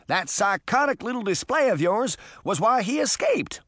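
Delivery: high, heated reproach; exasperated scolding; angry accusation. A narrator's reading. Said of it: "Mister Hampstead said angrily".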